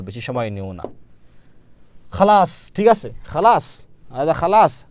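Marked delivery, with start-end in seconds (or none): 2.1-4.9 খালাস called out higher and louder; lively teacher drill. Shown as a demonstration. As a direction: sing out খালাস for the class to repeat. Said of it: "আপনাদের কিন্তু বারবার মুখ দিয়ে বলতে হবে". (উঁচু গলায় খালাস উচ্চারণ)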